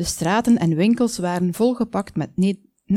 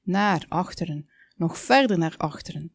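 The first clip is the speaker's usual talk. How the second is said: plain reading voice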